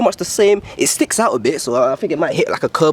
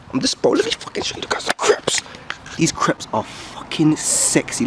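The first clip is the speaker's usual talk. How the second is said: hushed; 3 semitones lower, 5 dB quieter than usual. Real, not acted. Muttering while walking home.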